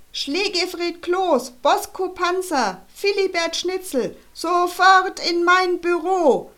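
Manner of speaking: höher und lauter, langsam herrischer Brüllton; brüll wie durch ein Megafon; Direktor brüllt. Acted. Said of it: "Die Stimme des Direktors ... Megafon". (Direktor brüllt aus dem Fenster über den Pausenhof)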